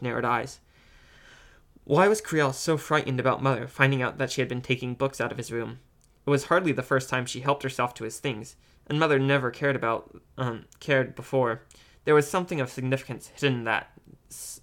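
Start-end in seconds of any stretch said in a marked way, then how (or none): none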